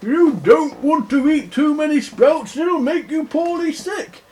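high, loud swooping bear voice; singsong cautioning bear; Big Bear. Acted. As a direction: warn like a fussing storybook bear. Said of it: "and Big Bear goes".

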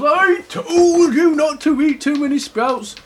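high, loud scolding bear; hammed-up told-you-so; Big Bear. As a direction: chide like a storybook parent bear. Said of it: "Big Bear goes".

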